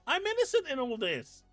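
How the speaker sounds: normal talking voice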